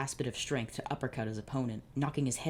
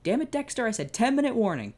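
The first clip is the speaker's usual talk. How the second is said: very high, loud exasperated yell; angry yell; 10 semitones higher, 6 dB louder than usual. Acted. Larry yelling at Dexter chasing the toy truck, furious. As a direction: bellow at the runaway toy. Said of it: "Larry said, yelled".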